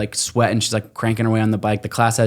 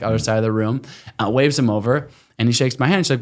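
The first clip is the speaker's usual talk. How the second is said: usual speaking voice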